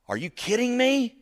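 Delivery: high, loud cry of disbelief; mock incredulous outburst. Preacher acting out reaction to a hard word, loud mock disbelief. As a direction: exclaim as if stunned by bad news.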